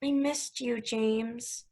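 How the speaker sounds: tender. Acted. Alicia telling James she missed him, soft and tender. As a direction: say it close and intimate.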